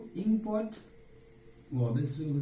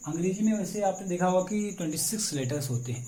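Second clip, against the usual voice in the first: usual speaking voice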